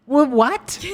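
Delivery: high, stunned double take; stunned what. Retold or imitated. Dad retells stunned reaction to son, high disbelieving what. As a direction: stammer in disbelief.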